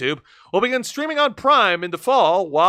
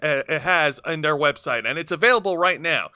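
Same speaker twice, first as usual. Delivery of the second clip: normal talking voice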